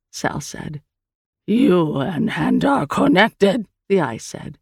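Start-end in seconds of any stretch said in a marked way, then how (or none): 1.5-3.7 slow, drawn-out alien voice; strange creature. Acted. The Eye explains the bond to Sal, slow creature voice. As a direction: state it slowly as an otherworldly being.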